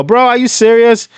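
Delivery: ordinary talk, nothing marked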